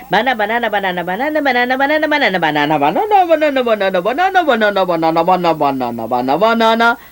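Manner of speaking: banana banana droned over and over like a stuck record; babbling banana on a loop; broken record. Shown as a demonstration. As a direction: repeat banana mechanically with swinging pitch. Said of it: "Pensaría que eres un disco rayado".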